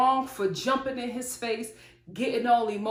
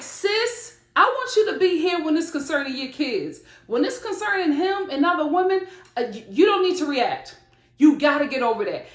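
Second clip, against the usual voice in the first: louder, higher, insistent talking-to; emphatic admonishing; 6 semitones higher, 6 dB louder than usual. Retold or imitated. Viewer tells a mother not to react, emphatic admonishing. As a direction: press the advice home like a frank sister. Acts out advising Sarah firmly.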